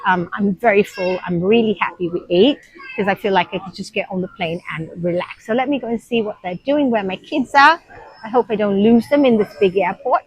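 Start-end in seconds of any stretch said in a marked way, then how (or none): none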